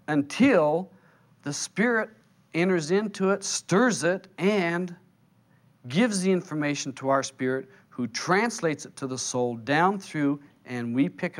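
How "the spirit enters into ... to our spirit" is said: usual speaking voice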